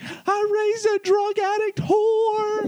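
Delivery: a crying mother wailing at her daughter; mock sobbing mom. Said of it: "she's crying".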